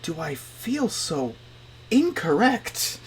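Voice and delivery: slow, drawn-out mock despair; slow high voice of exaggerated bewilderment; bewildered complaint. Acts out unmedicated bewilderment.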